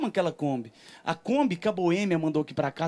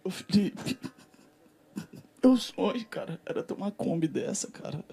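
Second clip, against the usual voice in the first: longing; 5 semitones higher than usual. Retold or imitated. Acted longing plea to Felipe.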